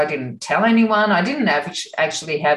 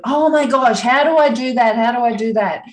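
put-on higher, louder eager child's questions; so excited; 6 semitones higher, 4 dB louder than usual. Son in the car asking his mum, excited. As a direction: bounce with a kid's eagerness. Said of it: "like, so excited".

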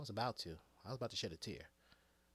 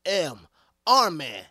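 loud, slow, high-stakes hero line; Iron Man quote; 3 semitones higher, 15 dB louder, slower than usual. Retold or imitated. Fan acting out Iron Man's final line, loud and slow. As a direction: boom it out like the last stand. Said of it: "he's like".

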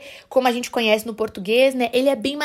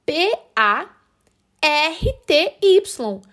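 slow, higher, letter-by-letter spelling; 4 semitones higher, much slower than usual. Shown as a demonstration. Teacher spelling the word for learners, slowly letter by letter. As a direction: spell it out with a gap after each letter. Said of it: "se eu for soletrar".

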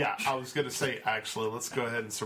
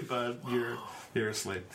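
normal talking voice

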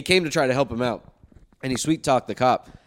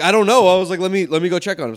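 rattled; 5 semitones higher, 6 dB louder than usual. Retold or imitated. Retelling rattled moment after crash.

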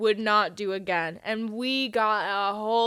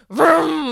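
loud, higher imitated engine rev; engine vroom; 5 semitones higher, 10 dB louder than usual. Host demonstrating how the bikes sound, loud engine noise. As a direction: rev it loudly with your mouth. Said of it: "might sound like this".

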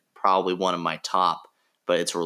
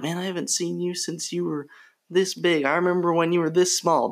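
nostalgic adult; 10 semitones higher than usual. Retold or imitated. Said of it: "they're like".

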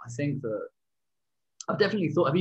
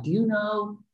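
slow, higher, drawn-out American stranger asking the way; imitating an American stranger, higher and very slow; mock American stranger; 6 semitones higher, 3 dB louder, slower than usual. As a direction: ham up the lost American guy, dragging each word. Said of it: "he's American".

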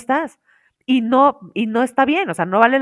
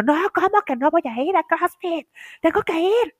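higher, indignant protest; mock indignant self; 7 semitones higher than usual. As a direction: play your past self refusing to skip CrossFit. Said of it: "y yo".

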